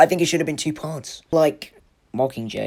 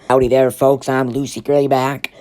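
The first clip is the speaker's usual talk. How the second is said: Southern accent impression; 6 dB louder than usual. Reviewer mimics Lucy's stage intro in a hammed Southern accent. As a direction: greet the crowd like a Southern stage performer. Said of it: "that accent".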